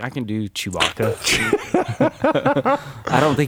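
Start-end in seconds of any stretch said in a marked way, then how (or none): 1.2-3.1 high burst of laughter; real high laugh at the bit. Real, not acted. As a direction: crack up laughing. Laughing at Chewbacca attempt.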